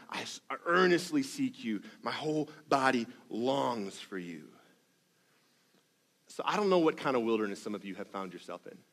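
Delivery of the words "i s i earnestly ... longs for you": hushed longing. Acted. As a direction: breathe the prayer quietly. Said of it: "how I would describe".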